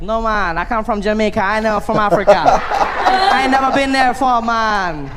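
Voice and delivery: insist, half laughing, you've never been there; Jamaican guy objecting. Retold or imitated. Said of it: "Guy's like".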